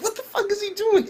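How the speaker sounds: shocked, pitch shooting way up; can't believe what he's seeing; incredulous high outburst. Real, not acted. Reacting to the anime to viewers, incredulous high outburst.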